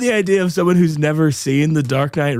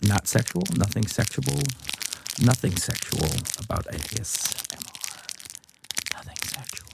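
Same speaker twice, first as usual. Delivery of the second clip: soft, breathy near-whisper for a mock ASMR bit; hushed ASMR voice; 10 dB quieter than usual. Host reassures ASMR listeners it's nothing sexual, hushed. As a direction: whisper it close into the mic.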